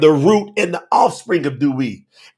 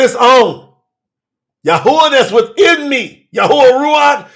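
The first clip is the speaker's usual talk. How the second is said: ordinary delivery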